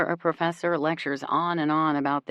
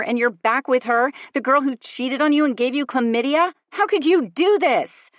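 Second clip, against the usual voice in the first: higher and louder, raging; furious accusation; 8 semitones higher, 7 dB louder than usual. A narrator's reading. To the cheating boyfriend, a furious accusing rant. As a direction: tear into him.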